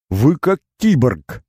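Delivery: excited yell. A narrator's reading. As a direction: blurt out in amazed delight. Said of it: "восхищённо завопил Изя".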